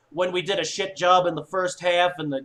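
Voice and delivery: ordinary delivery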